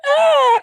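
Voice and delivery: very high, loud shocked shriek; mock shriek. The woman squeals at the pimp, high and loud. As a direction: squeal like she's been struck. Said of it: "she's like".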